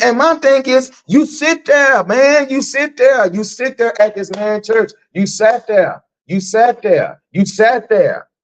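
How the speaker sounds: high, insistent repeated accusation; heated rant. Real, not acted. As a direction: hammer the point home, voice up. Ranting at friend, pitched up.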